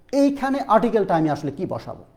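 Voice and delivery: normal talking voice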